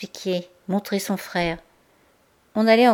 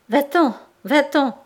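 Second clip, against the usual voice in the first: panicked, raised and slowed; frightened stammer; 7 semitones higher, 6 dB louder, slower than usual. A narrator's reading. Denise to her brother, stammering in fear. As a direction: push him away in terror.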